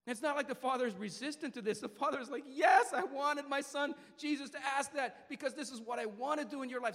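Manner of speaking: loud, high, driving sermon delivery; preaching at full force. Acted. Preacher lifting the crowd, preaching at full force.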